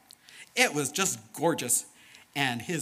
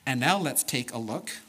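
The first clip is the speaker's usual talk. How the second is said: plain reading voice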